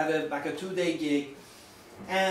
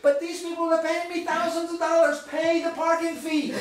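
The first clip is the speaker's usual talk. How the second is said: high, loud comic exasperation; exasperated realization; 10 semitones higher, 5 dB louder than usual. Retold or imitated. Facilitator to himself on the drive home, comically exasperated. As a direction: scold yourself emphatically, pitched way up. Exasperated punchline to his own thrift.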